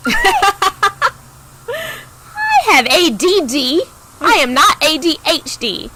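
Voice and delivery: high, loud, slow protest; mock-offended, high and loud; indignant correction.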